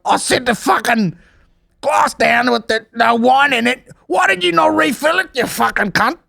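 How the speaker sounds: high put-on shriek of outrage; mock aggressive rant. Podcaster imitating a girl berating her partner over wine, high angry. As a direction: rant at him in a shrill mock fury. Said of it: "So aggressive".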